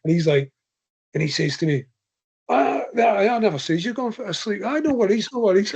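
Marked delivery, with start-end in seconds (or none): 2.5-5.8 higher-pitched, hurried-to-reassure mock protest; flustered denial. Andy denies saying it to the runner, flustered. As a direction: imitate a friend backtracking innocently. Backtracking friend, acted in a retold exchange.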